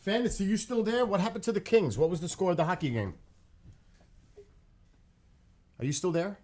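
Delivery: high, loud calling. Real, not acted. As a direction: call out across the house. Calling out to someone.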